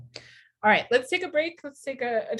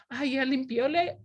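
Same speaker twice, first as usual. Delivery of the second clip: higher playful voice; teasing imitation, pitched up; mimicking husband mocking; 6 semitones higher than usual. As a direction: tease the boy's mistake. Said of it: "And so he's like".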